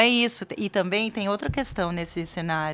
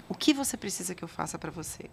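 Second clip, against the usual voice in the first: soft, lowered, almost whispered question; gentle offer; 5 dB quieter than usual. Retold or imitated. Parent offering comfort instead of solutions, gently. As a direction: ask softly, making room for an answer.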